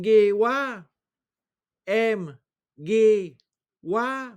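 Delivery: letters "M, G, wa" called out high and clearly; spelling aloud. Shown as a demonstration. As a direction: spell the phrase out for the class. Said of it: "comment s'écrit la phrase".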